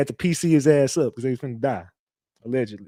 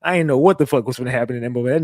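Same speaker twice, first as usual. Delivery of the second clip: ordinary talk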